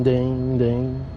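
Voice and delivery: ordinary delivery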